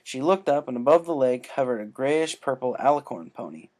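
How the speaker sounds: ordinary delivery, nothing marked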